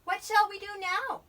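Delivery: high, loud little character voice; eager Little Bear. A narrator's reading. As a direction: ask keenly as a little bear.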